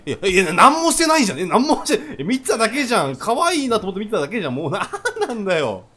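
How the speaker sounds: high, loud, half-laughing complaint; indignant protest. Real, not acted. Player objecting that he was only watching, laughing indignant protest. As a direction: protest innocence with amused outrage. Protests innocence to angry game character.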